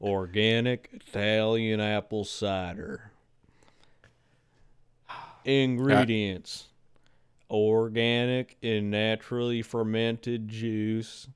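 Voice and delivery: put-on southern label reading; hammed-up southern accent, stretched words. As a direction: read it out in a mock southern accent. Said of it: "Why in a southern accent now?".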